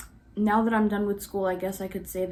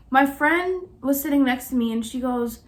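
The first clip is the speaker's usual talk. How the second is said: ordinary talk, nothing marked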